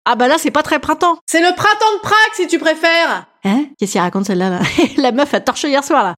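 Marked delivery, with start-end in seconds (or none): 1.3-3.3 high, indignant interjection; mock-militant heckler voice; scolding heckler. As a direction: butt in with a pitched-up correction. Heckler figure butts in, scolding.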